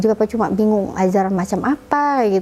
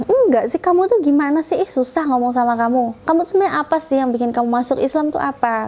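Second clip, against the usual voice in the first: retold exasperated sister, pitched up; higher-pitched, fed-up questioning; 4 semitones higher than usual. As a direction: imitate a sibling losing patience.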